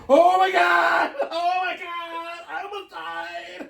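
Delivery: high, slow hammed-up crying wail; mock sobbing. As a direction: overact a tearful breakdown for laughs. Mock dramatic crying quote.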